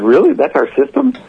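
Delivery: high, rising questions of disbelief; incredulous question, pitch soaring at the end; retold incredulity. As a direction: replay being stunned, voice climbing sharply. Retells his disbelief at lawyer's answer.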